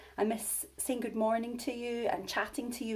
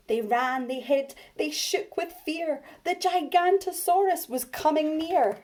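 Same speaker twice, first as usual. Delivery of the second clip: rising scared refrain; 7 semitones higher, 6 dB louder than usual. A narrator's reading.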